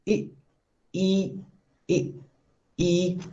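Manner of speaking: "E" as an isolated long ee vowel; long E sound. Shown as a demonstration. Teacher demonstrating the long E, isolated vowel. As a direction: sound out "E" separately, with pauses. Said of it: "long E".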